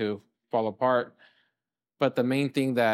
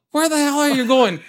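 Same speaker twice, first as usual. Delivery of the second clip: loud, very high-pitched mock yell; full-volume retold shout; imitated screaming; 9 dB louder than usual. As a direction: holler like a furious dad, played for laughs. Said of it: "he just started screaming at me".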